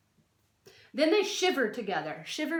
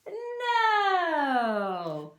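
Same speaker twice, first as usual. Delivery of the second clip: drawn-out playful no; a long mock-scolding no; emphatic no; 4 semitones higher than usual. Shown as a demonstration. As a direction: answer with a big teasing no. Emphatic answer to silly question.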